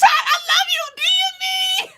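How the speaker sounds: very high, laughing, crying-out squeals; gushing squeal. Real, not acted. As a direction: squeal your love like an overjoyed fan. Gushing at a viewer on stream.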